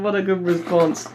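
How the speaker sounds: high-pitched praise on the tail of laughter; laughing delight. Retold or imitated. Teller praises his friend's comeback, laughing and delighted.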